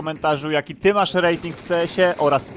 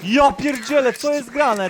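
surprised outburst; 7 semitones higher, 6 dB louder, slower than usual. Real, not acted. Shocked at game event.

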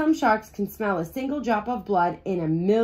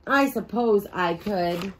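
usual speaking voice

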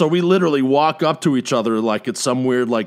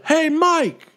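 calling out; 6 dB louder than usual. Retold or imitated. Strangers calling him by mistake.

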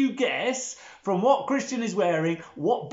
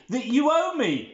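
high, insistent play-acted demand; demanding creditor; 7 semitones higher than usual. First servant to the servant who owes him, mock demanding. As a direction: press the claim theatrically.

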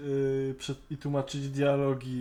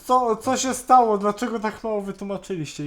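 higher, louder mock-baffled complaint, imitating fans; baffled fan outcry; 7 semitones higher, 8 dB louder than usual. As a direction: play a confused fan demanding answers. Fans baffled after season finale. (udawane, głośne oburzenie zdezorientowanego fana)

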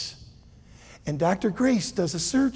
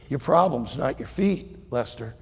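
calm doctor; 5 semitones lower, 5 dB quieter than usual. Acted. Doctor Law answers Lester.

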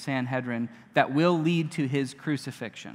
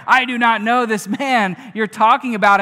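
cursing oath; 6 semitones higher, 11 dB louder than usual. A narrator's reading. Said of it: "He began to curse and swear".

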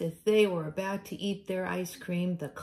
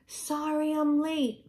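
high, louder apologetic cat voice; breathless latecomer; 10 semitones higher, 3 dB louder than usual. Acted. Freckles apologizing to friends, high and hurried. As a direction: squeak out an apology.

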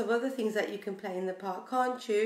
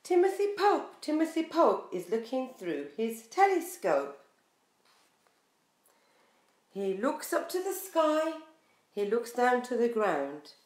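lively, sing-song picture book reading; reading to children. Acted. Teacher reading a picture book to her class, sing-song. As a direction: draw little listeners into the story.

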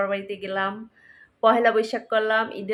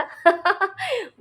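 high laugh; 9 semitones higher than usual. Real, not acted. Jokes about the mother's gift.

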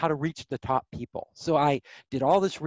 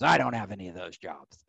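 gruff producer; 7 semitones lower than usual. Retold or imitated. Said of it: "He goes".

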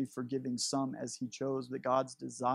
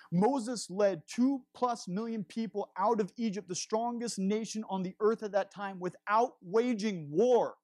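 building emphasis; 8 semitones higher, 4 dB louder than usual. Real, not acted. Preacher builds to a climax.

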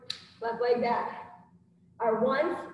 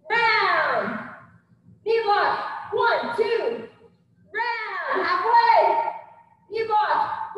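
boxing coach calling combos; 6 semitones higher, 4 dB louder, much slower than usual. Acted. Instructor calling combo reps.

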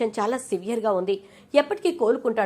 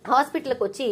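usual speaking voice

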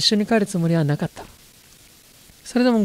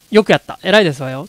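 loud approving cheer; 3 semitones lower, 7 dB louder than usual. Real, not acted. Cheering heroine on.

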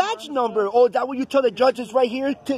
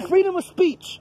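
higher, defiant shout at the officer; 7 semitones higher than usual. Real, not acted. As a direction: throw the slogan back in his face, higher. Arguing with officer, repeating slogan.

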